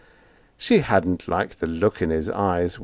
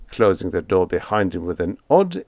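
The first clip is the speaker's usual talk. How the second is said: plain reading voice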